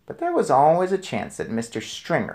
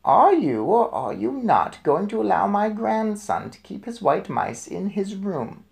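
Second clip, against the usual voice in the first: higher, insistent grandmother voice; demanding grandmother; 8 semitones higher than usual. A narrator's reading. Grandmother pressing the manager for an answer, insistent. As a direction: corner him with the question.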